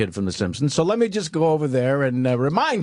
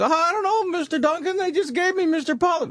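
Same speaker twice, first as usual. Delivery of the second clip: put-on high squeaky kid voice, pitched way up; nervous PA kid. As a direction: play the pimply young assistant, voice cracking high.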